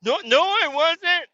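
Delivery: very high, slow whiny denial; whiny Kyle denying it. Retold or imitated. Kyle denying he was mean, high slow whine. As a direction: whimper it like a defensive kid. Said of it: "I'm like".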